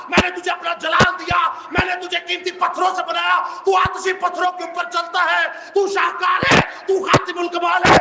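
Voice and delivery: preacher voicing God's stern challenge; God challenging Lucifer. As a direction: thunder it down as God rebuking his proud creation. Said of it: "زندہ خدا نے اسے بتایا، اسے challenge کیا".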